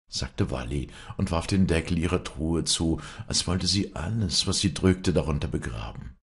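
plain reading voice

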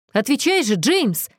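ordinary delivery

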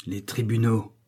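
a touch of a scornful mutter; muttered through teeth. A narrator's reading. Chained villain scoffs at justice, muttering between his teeth. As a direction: lightly chew the words between the teeth. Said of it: "mâcha le gredin entre ses dents".

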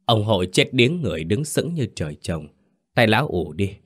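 usual speaking voice

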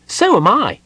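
high, loud, eager question; interested kender. A narrator's reading. Tass questions Gold Moon about her curse, with keen interest. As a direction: ask eagerly in a piping, loud voice. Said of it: "said Tass with interest".

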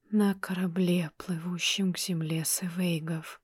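flat, toneless ghostly whisper; indifferent whisper. A narrator's reading. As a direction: whisper it without caring. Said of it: "безразлично ответил призрак".

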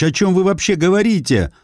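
high, pleading confusion. Acted. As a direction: beg for an answer, bewildered. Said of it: "взмолился Андрей Петрович". (умоляюще, в растерянности)

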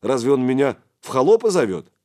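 taken-aback disbelief; surprised. A narrator's reading. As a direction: ask in astonishment. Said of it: "Духарев даже удивился".

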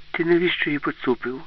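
mild accusation, pitch lifted; accusing Alice. A narrator's reading.

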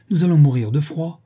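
half-joking complaint about the cold; exclaiming. A narrator's reading. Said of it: "s'écria-t-il".

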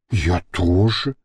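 low, slow, slightly offended; hurt. A narrator's reading. Model offended at being put off, hurt. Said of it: "обиженно заметила модель".

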